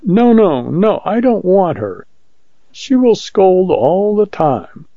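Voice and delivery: high-pitched woman's protest, slowing at the end. A narrator's reading. Woman refuses to have her mother brought, protesting.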